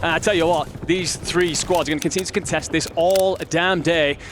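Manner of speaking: ordinary talk, nothing marked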